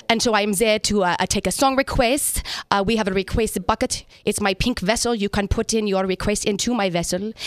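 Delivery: playing her German stage character, a bad German accent with pomp. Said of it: "Give us your best German accent".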